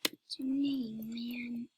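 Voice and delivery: slow, breathy whisper. Shown as a demonstration. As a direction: whisper it slowly. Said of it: "I'm just".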